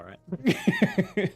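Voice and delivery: chuckling reassurance; laughing. Real, not acted. Laughing reassurance after racism joke.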